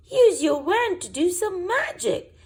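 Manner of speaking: high, loud shout across the water; loud urgent high call. Acted. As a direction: shout it out to sea. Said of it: "Missus Elf shouts".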